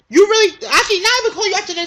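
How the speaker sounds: high, loud disbelief; shrill outraged disbelief; incredulous outburst. Acted. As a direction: rise up in outrage at the very idea. Incredulous rant at gossiper.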